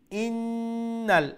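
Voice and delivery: ইন্নাল with the doubled n held very long; ghunnah demo. Shown as a demonstration. Teacher showing nun with tashdid, ইন্নাল with long held n. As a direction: hum the ন্ন in ইন্নাল, high and sustained. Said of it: "কিভাবে উচ্চারণ করতে হবে".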